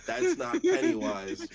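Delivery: words squeezed out through laughter; slow, high laughing protest. Real, not acted. Laughing at the bad impression.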